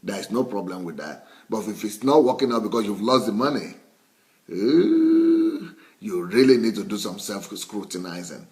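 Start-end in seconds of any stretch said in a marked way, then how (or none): 4.5-5.7 high, rising, drawn-out ooh; siren-like ooh climbing very high; alarmed "ooh". Real, not acted. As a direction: let out a long, loud whoop of dismay. Reacts to money being lost.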